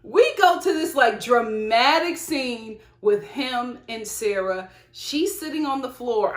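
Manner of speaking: normal talking voice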